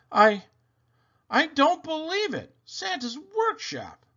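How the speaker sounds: wide-eyed gasping question; amazed boy. Acted.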